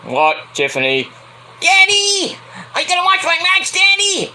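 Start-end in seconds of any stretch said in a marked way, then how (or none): 1.6-4.3 very high, whiny little-princess call; shrieky mock daddy's girl calling out; spoiled daughter. Plays Tiffany pestering her daddy.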